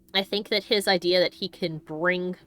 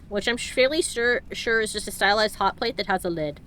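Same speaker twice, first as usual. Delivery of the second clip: ordinary talk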